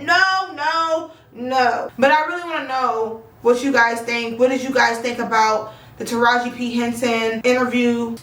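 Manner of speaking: ordinary talk, nothing marked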